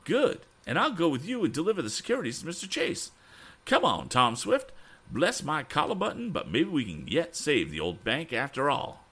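high, eager character voice; excited cry. Acted. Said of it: "Then Mister Damon cried".

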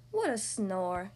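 low, very slow dismissal; bored disdain. A narrator's reading. Boris watches monsters bobbing for eyeballs, bored disdain. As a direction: drag the words out, unimpressed. Boris scorns the party game.

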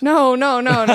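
loud, high string of denials; emphatic denial. Real, not acted. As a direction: deny it loudly, amused. Emphatic denial of keeping the shoes.

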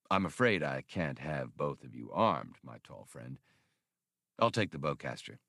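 a quiet gunman in control, lightly voiced; calm menacing gunman. A narrator's reading. Galandro demands the Wookiee's bowcaster, low cool politeness.

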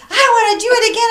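high, loud eager squeal; mock eager friend. Imitating her friend's eagerness, high and loud. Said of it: "the first thing she said".

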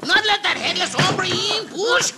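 frantic high-pitched pleading; panicked. Acted. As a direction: beg them to push, terrified. Holding the door against horseman.

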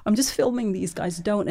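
mock pleading with protesters, hands up. Pepper sprayed by antifascist protesters.

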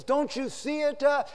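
high, drawn-out warning cry; acted urgent alarm. Editor pleads with confused workers, high urgent cry. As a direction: cry it out slowly, pitched high.

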